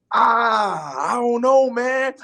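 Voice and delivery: drawn-out, high-pitched hesitation; reluctant. Retold or imitated. Newcomer doubting he can act, reluctant. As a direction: hem and haw, stretching it out high. Acted reluctant answer to friend.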